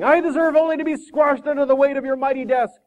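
high, loud, pleading self-abasement; hammed-up abject wail, tumbling into apology; grovelling. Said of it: "the man went right on groveling".

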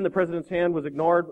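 usual speaking voice

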